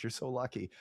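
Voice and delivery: usual speaking voice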